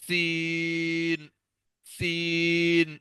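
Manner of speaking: سین with a long, slow whistling hiss; hissing seen. Shown as a demonstration. Teacher shows the whistling سین like a sparrow. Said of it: "سختی سے پڑھنا ہے".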